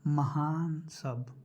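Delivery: usual speaking voice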